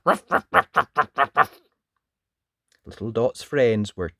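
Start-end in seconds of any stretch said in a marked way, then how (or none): 0.0-1.6 barking dog. Acted. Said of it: "Toto began to bark".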